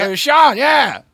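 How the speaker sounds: high, loud dawning reply; mock realization. Acting out someone catching on, high loud voice. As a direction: play a slow realization. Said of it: "They're like".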